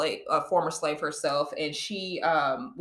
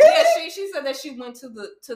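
laughing; 10 semitones higher, 9 dB louder, faster than usual. Real, not acted. Laughing at sister's joke.

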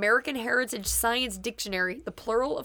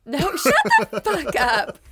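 slow, louder laughing retort; laughing outburst; 3 semitones higher, 5 dB louder, slower than usual. Real, not acted. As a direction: laugh it out loudly and slowly.

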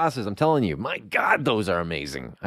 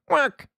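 high-pitched imitated quack; penguin quack; 11 semitones higher than usual. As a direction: squawk a quick penguin quack. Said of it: "a penguin comes along and he was like".

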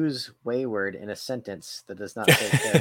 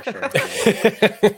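teasing drawl; 11 semitones higher, 8 dB louder than usual. Pretend.

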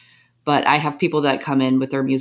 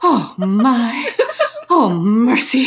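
high put-on old-lady sigh, a little louder; sighing sauna lady; 8 semitones higher, 5 dB louder, much slower than usual. Friend mimics the sighing lady in the sauna, high and moaning. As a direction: moan it like a weary lady settling in. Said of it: "and she'll walk in and she just goes".

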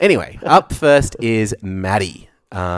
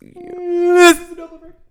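Doppler effect demo; 6 dB louder than usual. Shown as a demonstration. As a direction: voice swooping past the mic, high. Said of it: "Do you want me to demonstrate it for you?".

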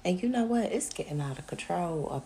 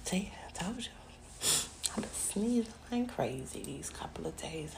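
whispering. Real, not acted. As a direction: keep it hushed to the mic. Speaking in low whisper.

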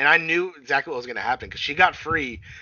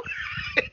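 short high burst of laughter; a squeaky giggle; a sudden high laugh; 6 dB quieter than usual. Real, not acted.

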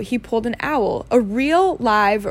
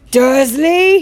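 loud, raised boom of a name; 4 semitones higher, 8 dB louder than usual. A narrator's reading. Hagrid about to explode at Dursley, booming. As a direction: bellow the name out. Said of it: "he boomed".

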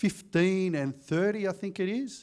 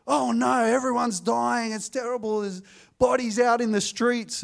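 mock alarm; 6 semitones higher, 3 dB louder than usual. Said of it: "they wanted something sensational".

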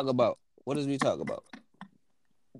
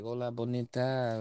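mock seductive Spanish; 2 semitones lower, 8 dB quieter than usual. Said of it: "that Spanish be like".

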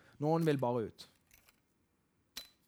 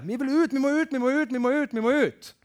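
hammed-up pushy evangelist chant; mock evangelist urging everyone out; eager evangelist; 6 semitones higher, 5 dB louder than usual. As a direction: chant it like a restless preacher itching to go. Imitating the eager evangelist type.

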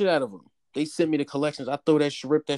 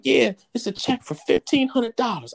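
grandma voice; 9 semitones higher than usual. Retold or imitated. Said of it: "She was like".

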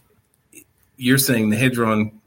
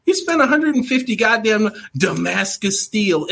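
mocking; 6 semitones higher than usual. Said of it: "And I was like".

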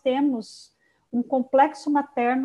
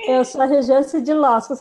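mock drowsy self-introduction; lightly imitating a sleepy friend; 7 dB louder than usual. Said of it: "coçando o olho".

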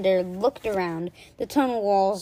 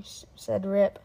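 ordinary delivery, nothing marked

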